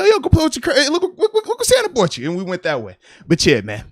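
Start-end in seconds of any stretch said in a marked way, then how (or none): 0.0-2.3 very high, loud coaxing; excited distraction. Retold or imitated. Dad steering son toward Santa's gift, hammed-up excitement. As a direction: hype a child up to change the subject.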